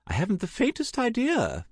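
very high, airy aunt voice; breezy high-pitched unconcern; airily careless. A narrator's reading. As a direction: toss it off with a verbal shrug. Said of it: "Tessa gave a careless shrug".

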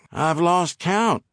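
a hillbilly twang, tired and hurting. Acted. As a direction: drawl it like a hillbilly. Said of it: "in his native hillbilly twang".

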